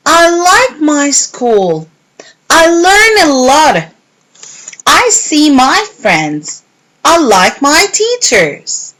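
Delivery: slow, clear classroom reading; a gently paced textbook read; reading aloud to children. Acted. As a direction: read it out for young pupils.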